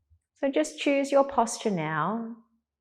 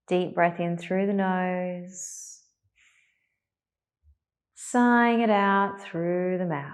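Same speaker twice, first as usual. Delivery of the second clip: calm, unhurried meditation voice with long pauses; guided meditation. Real, not acted. Teacher settling a class into meditation, calm and spacious. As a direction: ease them gently into stillness, leaving space to breathe.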